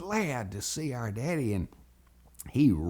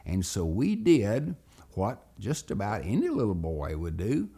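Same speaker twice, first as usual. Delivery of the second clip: ordinary delivery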